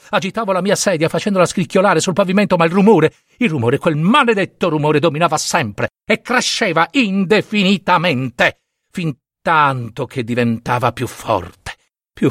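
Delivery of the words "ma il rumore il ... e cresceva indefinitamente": usual speaking voice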